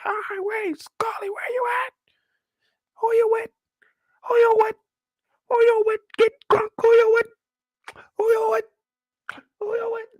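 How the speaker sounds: high, shrill-pitched woman shouting the same question; mock frantic woman calling out; frantic woman yelling. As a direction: yell it up at the building, desperate. Woman yelling for Scully outside.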